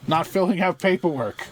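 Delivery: high-pitched indignant complaint; mock scream. Scientist complaining about her paperwork, high mock scream. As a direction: finish the comic tirade up high. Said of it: "where she is screaming".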